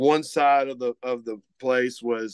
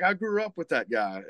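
put-on Cajun accent; 6 semitones higher than usual. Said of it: "emulate his accent".

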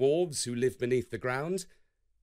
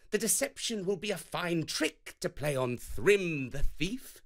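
higher, scheming Loki voice; Loki's sly plan; 6 semitones higher than usual. A narrator's reading. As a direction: Loki persuading Thor with a trick. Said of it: "Loki stepped forth and declared".

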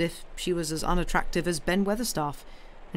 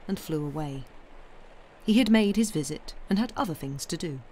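ordinary delivery, nothing marked

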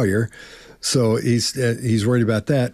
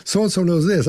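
tags 1: insistent denial; 7 semitones higher than usual. Pretend. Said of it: "first thing out of your mouth".

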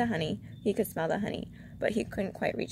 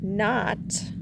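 louder, anxious single word; worried, pressing question; a touch of anxiety, projected; 3 semitones higher, 5 dB louder than usual. A narrator's reading. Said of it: "said Pooh anxiously".

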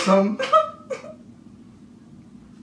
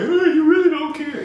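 high, louder mock whine; mocking whiny imitation; 5 dB louder than usual. Friend imitating roommate's whiny complaint, mocking. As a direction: mimic her whining. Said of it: "you gonna be like".

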